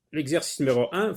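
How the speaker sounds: normal talking voice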